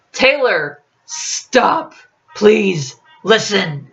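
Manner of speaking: a touch of desperation, pleading; lightly voiced desperate plea. A narrator's reading. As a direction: beg him to stop and hear you out. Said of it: "his tone laced with desperation".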